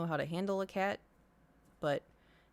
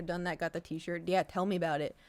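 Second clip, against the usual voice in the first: ordinary talk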